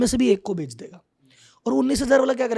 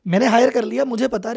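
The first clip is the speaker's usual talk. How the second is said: ordinary delivery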